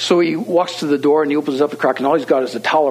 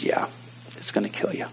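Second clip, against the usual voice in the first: very soft, low, whispery confirmation; grave hushed agreement; 4 semitones lower, 11 dB quieter than usual. Retold or imitated. Homeowner confirming the danger to the young man, gravely hushed. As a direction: imitate a sober older friend, nearly whispering.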